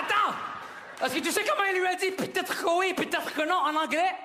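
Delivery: high-pitched, hurried disbelief; incredulous outburst. Acted. Son tells the audience about dad's translation, high incredulous outburst. Comedian marvels at father's English.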